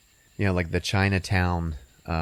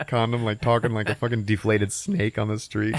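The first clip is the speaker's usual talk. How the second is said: usual speaking voice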